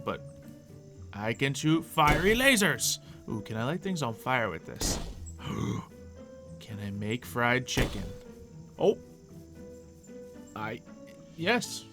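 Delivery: ordinary delivery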